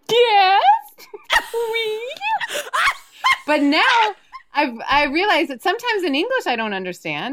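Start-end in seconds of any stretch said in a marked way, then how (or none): 0.1-2.0 mock eager yes. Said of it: "I'd be like".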